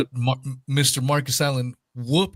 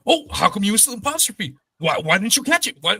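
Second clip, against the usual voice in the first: higher, fast, pouncing mock critic; nitpicking gotcha voice; 6 semitones higher, 3 dB louder, faster than usual. Imitating a rival who nitpicks a missing apostrophe, higher and fast. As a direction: lightly mimic a pedant catching a typo, quick and high. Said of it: "he'll just pounce on that".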